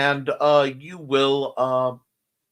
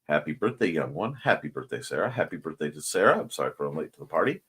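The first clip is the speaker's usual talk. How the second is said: much lower, quiet greeting; deep voice; 11 semitones lower, 8 dB quieter, faster than usual. Pretend. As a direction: drop the voice way down.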